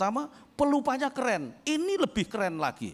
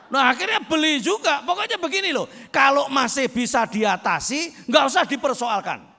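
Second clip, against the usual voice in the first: higher, louder, fast preacher's shout; preaching at full force; 9 semitones higher, 8 dB louder than usual. Acted. Preacher exhorts husbands to help their wives, loud fervent shout. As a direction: hammer the point home to the men.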